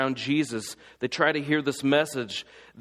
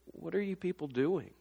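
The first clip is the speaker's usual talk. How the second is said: softer, stress on "are", falling; hushed exasperation; 10 dB quieter than usual. Retold or imitated. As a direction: mutter "what are you people doing?" under your breath. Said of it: "I want to say".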